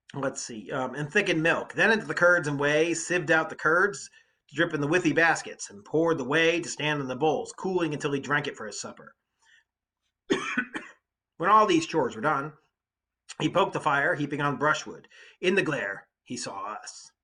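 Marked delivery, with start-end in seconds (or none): 1.0-9.1 loud, lively read-aloud of the epic; dramatic reading. Acted. Teacher reading the Odyssey to students, dramatic read-aloud. 11.4-17.1 loud, lively read-aloud of the epic; dramatic reading. Acted. Teacher reading the Odyssey to students, dramatic read-aloud.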